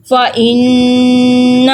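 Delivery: فَإِنَّ with the نّ held in the nose; slow nasal ghunna on فَإِنَّ. Shown as a demonstration. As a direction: hum the doubled ن of فَإِنَّ through the nose, slowly. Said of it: "گننا ہم تھوڑا ذرا ناک میں آواز نکالتے ہیں".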